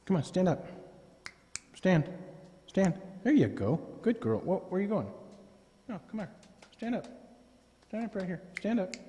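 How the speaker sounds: high, soft pet-talk commands; coaxing the dog. Real, not acted. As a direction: coax her gently up.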